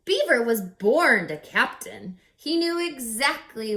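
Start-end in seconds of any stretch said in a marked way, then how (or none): none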